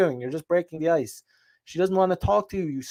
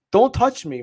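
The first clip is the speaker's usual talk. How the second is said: higher, sharp warning at a stranger; angry warning; 7 semitones higher, 4 dB louder than usual. Acted. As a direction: warn him off, heated. Imagined confrontation on the street.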